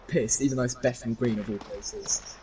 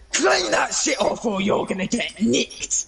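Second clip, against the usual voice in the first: mock shout; 7 semitones higher, slower than usual. Retold angry man at bag.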